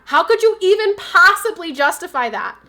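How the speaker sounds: heated. Real, not acted. Heated argument with co-host.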